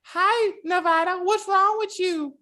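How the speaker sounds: put-on high, babyish little-girl voice; mock the actress's sugary child voice; fake kid voice. Mocking an actress's babyish kid voice to a cohost. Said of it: "the fake ass kid voice".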